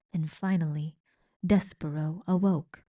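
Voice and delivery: plain reading voice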